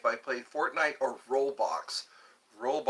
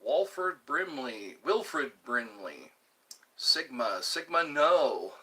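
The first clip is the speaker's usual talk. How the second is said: ordinary talk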